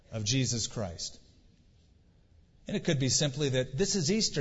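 ordinary talk, nothing marked

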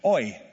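oi said like the Yiddish cry; oi as a lament; Hebrew oi. Shown as a demonstration. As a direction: say oi as the Hebrew word for woe. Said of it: "is a word in Hebrew".

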